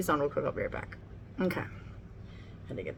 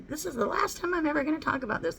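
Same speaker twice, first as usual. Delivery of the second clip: high-pitched mock vow; mocking imitation; 10 semitones higher than usual. Vlogger mimicking a YouTuber's broken promise, mocking. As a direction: mimic someone's empty promise in a higher voice.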